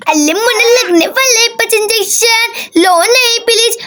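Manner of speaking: put-on childish baby-talk lisp; lisping little girl. Daisy comforts the baby.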